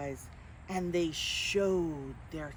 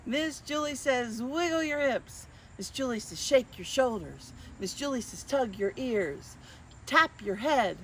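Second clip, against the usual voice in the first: higher, bouncy game-caller voice; game leader; 5 semitones higher, 3 dB louder than usual. Acted. Miss Julie calling commands to children, playful. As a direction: keep the kids guessing. Leads Simon Says game.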